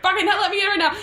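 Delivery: very high-pitched outburst; mock furious demand. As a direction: demand it in an exaggerated squawk. Alex demanding entry, acted.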